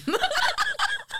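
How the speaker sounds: high squealing laugh-cry; shrieking laugh. Real, not acted. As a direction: burst into a shrill laughing squeal. Laughing at the absurd diagnosis.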